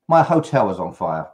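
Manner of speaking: ordinary delivery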